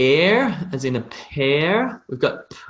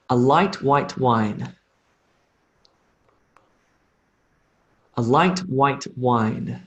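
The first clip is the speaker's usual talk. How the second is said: slow model sentence; slower than usual. Shown as a demonstration. Teacher modelling a phrase for learners to repeat, slow. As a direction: say it slowly so learners can copy. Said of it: "Repeat after me".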